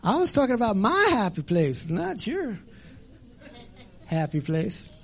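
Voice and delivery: high, cheeky rabbit retort; lightly voiced trickster rabbit; smug rabbit. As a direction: gloat like a sly rabbit. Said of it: "Rabbit says".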